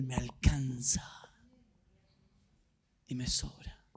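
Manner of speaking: slow, low, fading to near-whisper; hushed testimony. Real, not acted. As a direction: stretch it out, then let the voice sink away. Climax of testimony, voice drops.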